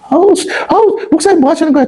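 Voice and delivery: high-pitched, put-on groan of complaint; acted exasperated grumbling. Congregants groaning in complaint at pastor.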